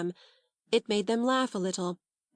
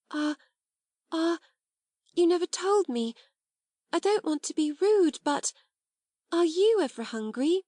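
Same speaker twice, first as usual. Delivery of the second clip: lightly voiced young girl; 8 semitones higher than usual. Ermengarde voiced, hesitant girl.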